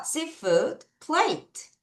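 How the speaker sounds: very slow "Seafood plate"; slow lead reading; slow choral reading. Shown as a demonstration. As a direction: lead the class slowly. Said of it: "같이 읽어요".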